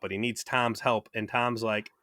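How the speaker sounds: normal talking voice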